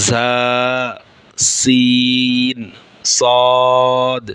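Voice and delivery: জা, সিন, সদ each held long and clear, sin stretched very slowly; Arabic letter sounds. Shown as a demonstration. Teacher demonstrating জা, সিন, সদ to students, drawn out. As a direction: sound out জা, সিন, সদ crisply, one by one like a Quran teacher. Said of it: "তিনটি হরফ উচ্চারিত হয়".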